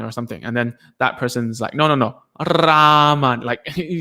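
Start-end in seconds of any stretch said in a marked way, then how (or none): 1.7-3.4 "Raman" with long rolled R and stretched vowel; slowed-down correction. Retold or imitated. Indonesian speaker correcting a learner, slowed-down trilled "Raman". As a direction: correct them, drawing "Raman" out slowly with a strong trill. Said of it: "he slowed it down for me".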